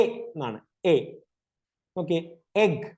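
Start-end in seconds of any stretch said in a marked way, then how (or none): 0.8-0.9 short "എ" as at the start of egg; letter E sound. Shown as a demonstration. Teacher showing children E's sound, short isolated "എ". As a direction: say "എ" alone, not the letter name. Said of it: "ഈയുടെ sound".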